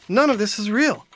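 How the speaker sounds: ordinary delivery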